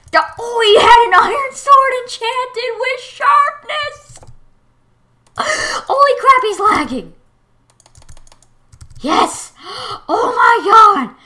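high, loud terrified cries; shrieking in real panic; freaked out. Real, not acted. Gamer freaked out by an enchanted-sword enemy, shrieking. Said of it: "I'm just so freaked out right now".

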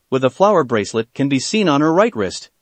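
usual speaking voice

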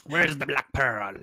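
slow Jack Sparrow style pirate. Pretend. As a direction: drawl it like a drunk pirate captain.

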